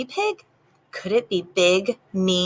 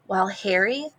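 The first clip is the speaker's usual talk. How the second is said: usual speaking voice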